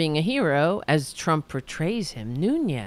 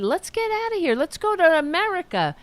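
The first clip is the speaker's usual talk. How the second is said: put-on a weak Irish accent, pitched much higher; half-hearted try at the Irish accent; attempted Irish accent; 9 semitones higher than usual. Grandmother wanting to leave Ireland, in a weak Irish accent. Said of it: "I can't do an Irish accent".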